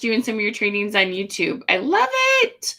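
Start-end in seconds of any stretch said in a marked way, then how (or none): 1.7-2.7 high-pitched gushing; acted fan excitement; gushing enthusiasm. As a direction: gush it like a delighted fan. Voicing a viewer's enthusiastic comment.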